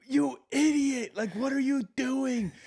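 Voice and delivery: high, slow, drawn-out angry yell. Retold or imitated. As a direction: yell at the player on screen like he blew it. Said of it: "I was yelling at my T V".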